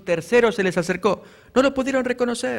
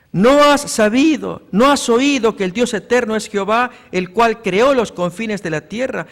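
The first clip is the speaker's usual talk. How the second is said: preaching scripture; 5 dB louder than usual. Acted. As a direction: declaim the verses to the whole church. Reads Isaiah aloud.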